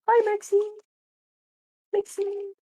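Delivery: high pet-talk greeting; sweet talk to a pet; cooing at a cat. Real, not acted.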